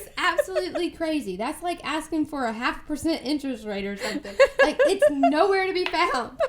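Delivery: loud, high, laughing through it; laughing retelling. Retold or imitated. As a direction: laugh while quoting him.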